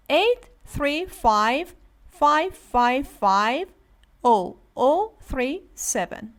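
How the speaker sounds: each number said slowly and apart; slow digit by digit. Shown as a demonstration. Teacher reading a number to students, slow and separate. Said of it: "One by one, read the numbers".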